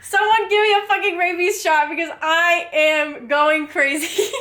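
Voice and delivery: giddy laughing outburst; laughing frenzy. Real, not acted. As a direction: laugh out the rant, half wild. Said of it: "I literally need to be put down".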